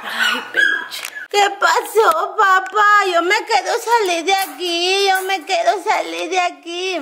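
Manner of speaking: high whiny puppy voice with cry. Acted. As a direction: whine like the crying puppy. Voicing the puppy's complaint. (voz de perrito llorón y aguda)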